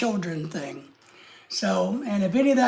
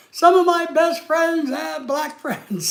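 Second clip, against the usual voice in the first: slow, slightly higher put-on voice; mock troll voice; 5 semitones higher, slower than usual. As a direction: lightly voice a defensive troll. Mocking troll's excuse, then laughs.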